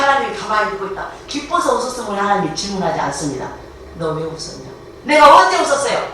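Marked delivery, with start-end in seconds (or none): 4.0-4.7 low, softer pointed question; questioning authority. Acted. God confronting Sarah over her laugh, quietly questioning. 5.0-6.1 higher, louder protest; indignant denial. Acted. Sarah answering God's accusation, loudly protesting. Sarah denies laughing, acted.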